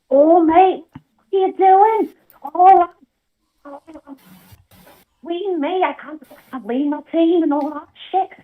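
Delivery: Liverpool Scouse voice; broad Scouse accent. Pretend. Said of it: "I'll do Scouse".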